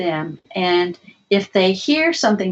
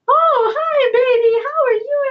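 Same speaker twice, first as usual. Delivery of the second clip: very high sing-song motherese; mom's baby talk. Acted. Mom comes in to change diapers, high sing-song baby talk. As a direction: greet a baby in a high, lilting coo. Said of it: "When mom comes in to change diapers".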